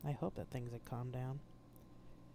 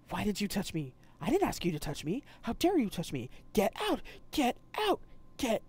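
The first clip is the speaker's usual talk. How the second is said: building from indignant to loud higher shouting; angry yell; 5 semitones higher, 5 dB louder than usual. Acted. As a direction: scream at Po to get out. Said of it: "he yelled".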